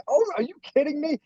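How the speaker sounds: a jump up in pitch, amazed; can't believe the praise; disbelief. Retold or imitated. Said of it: "I'm like".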